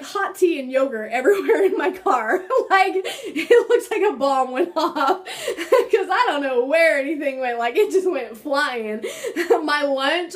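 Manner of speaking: laughing through the words. Real, not acted. Mom-to-be laughing about tea and yogurt everywhere, laughing. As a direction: giggle while describing the mess.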